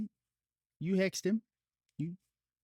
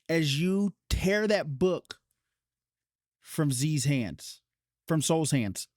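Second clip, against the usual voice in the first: ordinary talk, nothing marked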